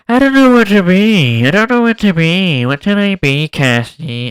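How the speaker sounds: high, loud put-on whiny character voice; imitating Modok. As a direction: lament it in a silly high voice. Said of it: "modok's like".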